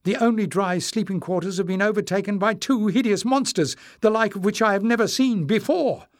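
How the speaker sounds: high, agitated report; anxious. A narrator's reading. Azan reports monsters to Knowles, anxiously. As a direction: blurt out the alarming news, pitched well up. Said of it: "his face furrowed with anxiety".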